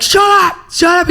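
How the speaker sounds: high, loud repeated yell; teacher's shout. Retold or imitated. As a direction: imitate a teacher yelling down screaming students. Teacher silencing screamers in dark.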